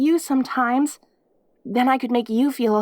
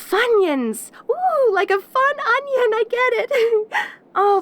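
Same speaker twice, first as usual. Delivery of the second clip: slow, much higher, savoring delight with a teary laugh; giddy delight; 9 semitones higher, slower than usual. Acted. Demon marvels at the Funyuns the visitor brought, giddy. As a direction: gush over the snack like a treasure.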